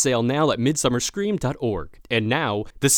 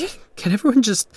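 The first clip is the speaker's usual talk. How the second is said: frustrated plea; 9 semitones higher than usual. Acted. Groom overwhelmed by questioning guests.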